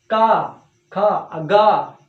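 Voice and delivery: very slow का, खा, गा; slow drawn-out demo. Shown as a demonstration. Teacher slowly drawing out का, खा, गा for class. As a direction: stretch each syllable out slowly. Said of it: "नाक कान सब लग जा रहा है".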